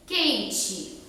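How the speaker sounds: "quente" ending in a "chi" sound; final E as I. Shown as a demonstration. Teacher showing children a spelling trap, final E as I. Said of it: "A gente fala quente como se fosse um i no final".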